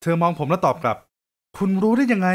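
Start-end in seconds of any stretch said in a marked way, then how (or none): 1.3-2.3 suspicious surprise. Acted. Woman catches him again.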